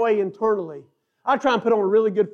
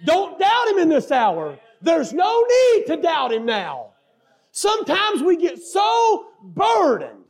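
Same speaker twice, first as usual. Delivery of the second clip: raised, louder preacher's cadence with stretched key words; full-force preaching; 7 semitones higher, 3 dB louder than usual. Acted. Preacher urging the congregation not to doubt God, full-force preaching. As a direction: drive it home to the congregation at high pitch.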